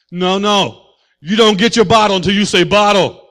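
loud, scolding parent voice; hammed-up strict parent; mock strict parent. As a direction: play a stern parent withholding the bottle. Strict parent refusing bottle.

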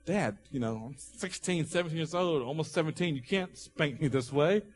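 soft, halting protest to a dad; a hint of a pleading teenage boy; teenage son. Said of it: "the son was like".

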